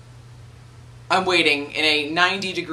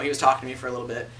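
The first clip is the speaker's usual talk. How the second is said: ordinary talk, nothing marked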